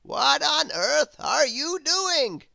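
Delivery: high, slow, loud wizard; a grand old wizard, astonished. Acted. The Wizard puzzled at Grizzle's noises, high wizard voice.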